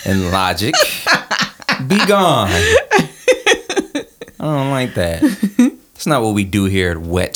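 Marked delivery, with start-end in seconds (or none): 1.9-5.2 high, very slow, laughing dismissal; mock banishing. Podcast host jokingly banishing co-host's logic, laughing. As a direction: shoo the logic away theatrically.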